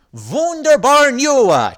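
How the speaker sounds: high loud joyful cheer; glad cheer. Acted. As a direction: cheer it out with delight. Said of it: "cheered Mister M gladly".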